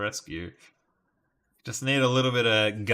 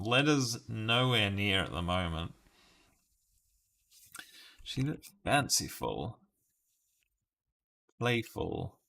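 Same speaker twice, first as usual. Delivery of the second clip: normal talking voice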